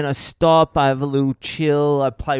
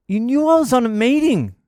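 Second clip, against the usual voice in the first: much higher, louder put-on wife voice; scolding wife; 13 semitones higher, 5 dB louder than usual. Wife scolding husband who crashed her meeting, high and loud. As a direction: scold him like an irritated wife.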